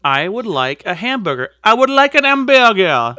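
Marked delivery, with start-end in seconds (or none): 1.6-3.1 louder, hammed-up French accent; mock French accent. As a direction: order it like a Frenchman in a cartoon.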